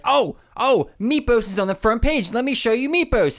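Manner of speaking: high, eager retelling of Larry; excited Larry. Retold or imitated. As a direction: pitch jumps way up, gushing. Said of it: "and Larry's like".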